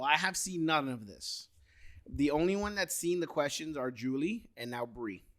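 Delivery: normal talking voice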